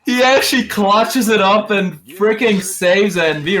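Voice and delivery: slow, high, loud hype call; excited esports caster. Real, not acted. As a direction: drag out the clutch call in awe. Caster reacting to a clutch save.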